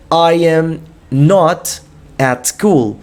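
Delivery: drawn-out teacher demo; slowly modeling the English; slow model phrase. Shown as a demonstration. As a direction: stretch each word for the class. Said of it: "I am not" demo".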